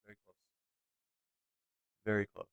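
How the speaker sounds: words stretched through a yawn. Real, not acted. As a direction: talk while yawning, late at night. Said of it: "I keep yawning".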